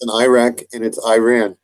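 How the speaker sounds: Iraq and Iran both opening with "eye"; long-I Iraq and Iran. Shown as a demonstration. Arguing it works like iPhone, long-I Iraq and Iran. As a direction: say "eye-rack" and "eye-ran". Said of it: "I say Iran and Iraq".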